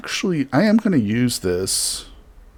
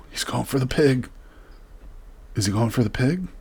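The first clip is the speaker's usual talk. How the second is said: ordinary talk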